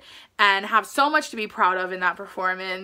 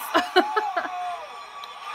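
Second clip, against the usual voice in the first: high bursting laugh of delight; giddy laugh; 6 semitones higher, 4 dB quieter than usual. Real, not acted. Laughing at cheer routine.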